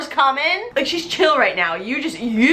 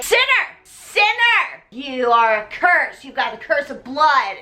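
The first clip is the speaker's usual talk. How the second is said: zealot mother ranting. Retold or imitated. Religious mother condemns Carrie for her period, ranting. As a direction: condemn her like a fanatic preacher. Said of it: "No, she's like".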